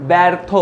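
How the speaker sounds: ব্যর্থ as 'baertho', the first vowel like English 'bat'; demonstrated pronunciation. Shown as a demonstration. Teacher showing students how it is pronounced, ব্যর্থ with open 'ae'. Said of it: "এর উচ্চারণ কি".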